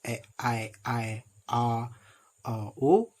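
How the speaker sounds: isolated vowels E, I, O said apart and drawn out; vowel sounds demo. Shown as a demonstration. Teacher isolating short vowels for students, careful demo. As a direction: lift each vowel out on its own. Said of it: "the short vowel I".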